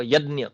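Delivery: यज्ञ said as "यद्न्य"; यज्ञ with a d-ny sound, Marathi style; Marathi pronunciation. Shown as a demonstration. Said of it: "Marathi आदमी क्या कहेगा". (मराठी ढंग से "यज्ञ" को यद्न्य बोलना)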